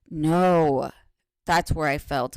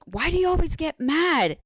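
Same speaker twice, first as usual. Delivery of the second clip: put-on higher, louder whiny child's complaint; imitating her daughter; 11 semitones higher, 4 dB louder than usual. Daughter complaining to her angry mother, imitated higher and louder. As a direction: mimic the daughter's hurt protest. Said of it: "And she's like".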